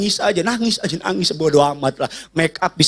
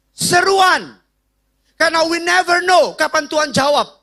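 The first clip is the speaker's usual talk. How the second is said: full-force preaching; 11 semitones higher than usual. Acted.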